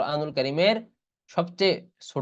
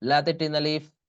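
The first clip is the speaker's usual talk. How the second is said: slow melodic tajweed chanting; Quran recitation; 3 semitones higher, much slower than usual. Shown as a demonstration. Teacher reciting the surah for students, slow chant. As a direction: draw the vowels out in recitation.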